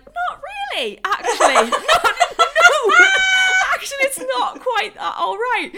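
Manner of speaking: hammed-up protest, half laughing; indignant mock outburst. Telling the shopkeeper off over the missing parcel, loud laughing indignation.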